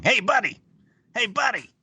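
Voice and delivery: high eager hey buddy; overfriendly pal voice; chummy Miles. Retold or imitated. Mimics Miles greeting alien.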